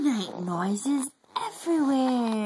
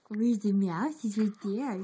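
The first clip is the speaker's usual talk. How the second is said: ordinary delivery, nothing marked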